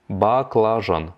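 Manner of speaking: баклажан said slowly, every syllable clear; slow teaching repeat. Shown as a demonstration. Teacher slowing баклажан down for the class. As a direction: stretch out баклажан for learners. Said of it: "slow repeat of vocabulary".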